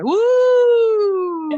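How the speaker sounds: long, high, loud "woo" cheer; excited whoop. Real, not acted. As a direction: whoop it up for the guest. Host cheers the guest's game.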